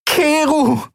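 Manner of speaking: high, pleading woman's voice, calling a name. Acted. Endril calls Keru over the cube, pleading. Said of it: "flehte sie".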